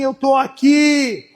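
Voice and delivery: hushed tender promise; inner whisper of the Spirit. Acted. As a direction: say "Eu tô aqui" slowly as a gentle reassurance. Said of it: "pro meu espírito falar dentro de você assim".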